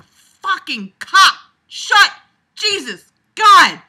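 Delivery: loud, high frustrated screaming; frustrated screams. Real, not acted. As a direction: scream it out in exasperation. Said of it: "I am ready to scream".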